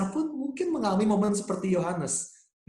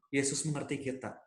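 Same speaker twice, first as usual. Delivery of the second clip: ordinary talk, nothing marked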